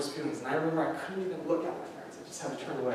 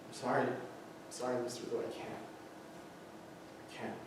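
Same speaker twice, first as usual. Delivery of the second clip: soft, much quieter apology; the judge, moved, softly saying she can't; emotional judge; 3 semitones lower, 13 dB quieter than usual. Retold or imitated. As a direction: fade to almost nothing on I can't. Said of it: "she actually gets emotional".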